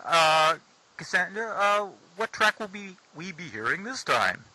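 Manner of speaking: host it like a DJ; radio voice. Pretend. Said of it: "that's my radio voice".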